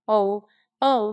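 loud, mildly furious oh oh; a touch of a fury peaking; understated furious wails. Acted. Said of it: "a perfect fury".

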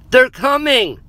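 high, loud warning yell; alarmed shout. A narrator's reading. Narrator warns friends of the approaching herd, shouting. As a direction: yell it to warn the others. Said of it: "I shouted".

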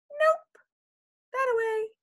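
very high odd critter voice; silly critter voice. Acted. Critter answers the kitten, very high. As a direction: answer curtly as a small critter. Animal points.

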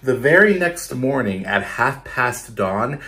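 put-on lively, rhythmic read-aloud for kids; storytelling to children. Teacher reading a picture book to her class, lively read-aloud. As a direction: bounce the rhymes for a class of children.

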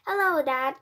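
loud, high, carefree hello; cheeky boy's greeting. A narrator's reading. Mouse son greets his shocked father, loud and carefree. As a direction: call out brightly to Dad.

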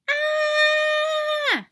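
loud high panic scream. Acted. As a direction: shriek in terror. Germs scream at washing.

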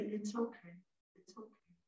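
very soft, hushed reassurance; tiny comforting murmur; gentle soothing. Shown as a demonstration. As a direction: coax a scared little turtle, almost whispering. Soothing a scared turtle.

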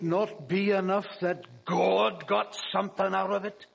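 a light, self-admittedly poor Scottish brogue; retelling the Scottish pastor's rebuke in a Scottish accent. Retold or imitated. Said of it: "my best imitation of a Scottish brogue, which is a very poor imitation".

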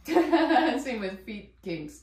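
slow, laughing through the words. Real, not acted. Laughing at her own joke to viewers. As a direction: giggle it out slowly. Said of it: "laughing at own joke".

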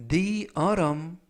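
The autumn, slowly, T flapped like r; autumn을 아럼처럼 천천히 또박또박; English pronunciation demo. Shown as a demonstration. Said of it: "autumn이라고 발음하시면 되겠고요". (천천히 발음 시범)

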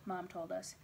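plain reading voice

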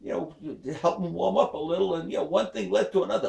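put-on high girl voice, quick at the end; coy daughter. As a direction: sheepishly explain it away. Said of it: "She says".

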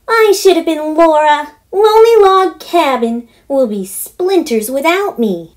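put-on high, loud, half-sung dramatic girl; theatrical girl. Daughter boasting of her audition, high and sing-song. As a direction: ham it up like a child actress.

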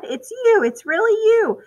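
very high, excited and loud; overjoyed cry. Acted. Mother welcoming her son back home, very high overjoyed cry. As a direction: gasp it out, can't believe he's home. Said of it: "she cried".

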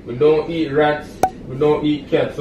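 normal talking voice

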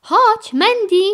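high, loud summoning call. A narrator's reading. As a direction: call her over. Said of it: "zawołał Ryder".

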